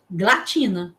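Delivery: "glatina" with hard g run straight into l; unwary misreading. Shown as a demonstration. Unwary readers seeing the child's spelling, "glatina" with hard gl. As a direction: drop the first vowel, "glatina" with hard gl. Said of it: "As pessoas mais desavisadas iriam ler".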